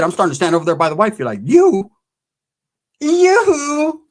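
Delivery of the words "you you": outraged. Retold or imitated. Mock outrage at the girlfriend.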